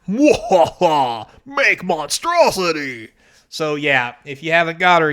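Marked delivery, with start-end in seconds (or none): 0.1-3.1 mad scientist. Pretend. Imagines Doctor Madison creating a monster.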